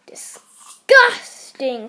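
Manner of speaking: loud, long-drawn revulsion; disgusted. A narrator's reading. Mister Gregg rejecting worm burgers, disgusted. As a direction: stretch the word out with disgust.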